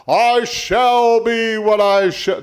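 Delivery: high, loud, drawn-out proclamation; mock declaration. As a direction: boom it out slowly, stretching every word. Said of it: "It didn't say".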